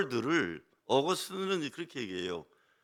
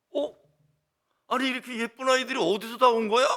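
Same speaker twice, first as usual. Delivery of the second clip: much higher and louder, delighted amazement; fussing with children; 11 semitones higher, 7 dB louder than usual. Retold or imitated. Old pastor greets Sunday school children, hammed-up delight. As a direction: gush over the kids like a doting grandpa. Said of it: "호들갑을 떨죠".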